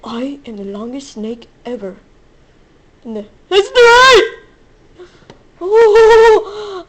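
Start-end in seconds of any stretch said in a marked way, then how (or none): 3.0-4.2 high, loud triumphant cry; loud bragging yell; excited yell. Real, not acted. Triumphant yell about snake. 5.6-6.8 excited yell. Real, not acted. Continues yell.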